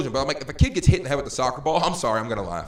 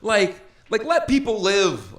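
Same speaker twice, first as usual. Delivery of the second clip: exasperated plea; 11 semitones higher, 7 dB louder than usual. Retold or imitated. Exasperated plea to fighting camps.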